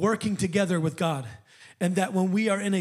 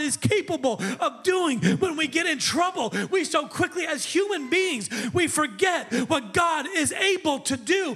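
preaching at full force; 6 semitones higher than usual. Acted. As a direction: lift the church up, full voice.

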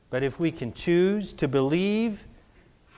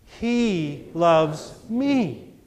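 drawn-out He loves me; slow repeated affirmation; much slower than usual. Shown as a demonstration. Pastor leading the church in a slow affirmation, slow. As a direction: say it slowly, letting it sink in. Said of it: "And now say".

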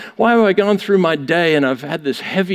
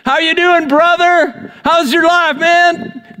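much higher, bright mock-friendly hello; chirpy church greeter; 10 semitones higher, 3 dB louder, slower than usual. Churchgoer greeting a sister on Sunday, chirpy and high. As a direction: play the cheery churchgoer at the door.

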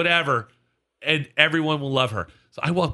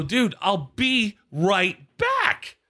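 very slow, higher, spelled-out retort; exasperated; 6 semitones higher, much slower than usual. Retold or imitated. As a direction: stretch each word in retold exasperation. Exasperated answer to the heckler.

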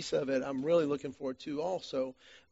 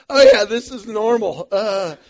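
loud, higher laugh-cry through the words; laughing strained; 5 semitones higher, 16 dB louder than usual. Retold or imitated. Cramped husband reassuring wife, loud strained laugh. Cramped runner pretending okay.

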